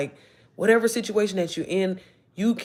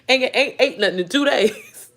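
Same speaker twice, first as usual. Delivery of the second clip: laughing; 3 semitones higher than usual. Real, not acted. Laughing at her broke days.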